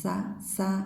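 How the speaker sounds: "sa" hissed lightly; tongue barely touching on sa; sibilant sa. Shown as a demonstration. Teacher repeating sa like in English. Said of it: "like this".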